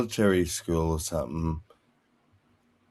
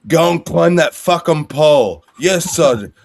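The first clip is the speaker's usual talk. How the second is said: louder, higher barked command and snap reply; barked order; 8 semitones higher, 9 dB louder than usual. Retold or imitated. Acting out a sergeant ordering a recruit, loud and high. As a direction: bark the order, then snap back yes.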